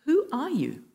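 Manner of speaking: a mildly acted demand to know who; retold challenge. Warlord questioning the mysterious voice, lightly acted challenge. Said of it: "The warlord said".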